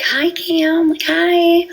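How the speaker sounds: family greeting the boy in high, bright-toned voices, mildly imitated; high sing-song greeting. Family greeting her son, in a high sing-song imitation. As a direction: lift the voice way up, sing-song hello to a child. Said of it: "my whole entire family was like".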